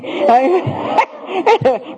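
short burst of high laughter. Real, not acted. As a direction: laugh at the irony. Laughs at dad's remark.

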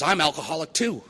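mock-eager cry with a high swoop at the end; eager fellow-sufferer. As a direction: declare it gladly as you join him. Acted recovered alcoholic jumps in.

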